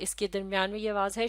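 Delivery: normal talking voice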